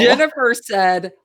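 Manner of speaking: ordinary talk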